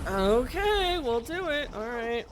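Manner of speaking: high, loud mock concession, easing down; writers giving in. Game writers answering fans' romance requests, mock giving in. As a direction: play writers caving to fan demands.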